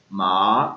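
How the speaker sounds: मा said low and rising; second tone ma. Shown as a demonstration. Teacher repeating the rising second tone. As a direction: glide मा upward. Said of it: "second मा".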